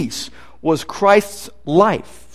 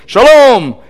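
booming voice; 5 semitones higher, 5 dB louder than usual. Retold or imitated. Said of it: "a little guy with a booming voice".

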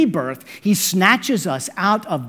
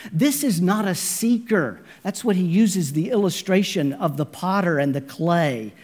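ordinary delivery

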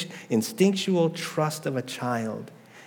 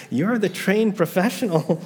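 higher and louder, laughing disbelief at the doctor; incredulous laughing retort; 6 semitones higher, 4 dB louder than usual. Retold or imitated. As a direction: toss it back, amused and incredulous, stress "trained".

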